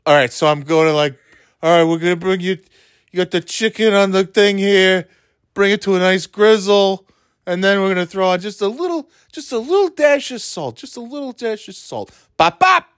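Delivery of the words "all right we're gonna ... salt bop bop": higher, bouncy chef host, yelling the bop bop; host patter ending in a shouted catchphrase; cooking show host. Shown as a demonstration. Demoing her cooking show, building to a yelled bop bop. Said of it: "I'm gonna yell it every time".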